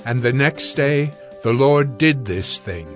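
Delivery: ordinary talk, nothing marked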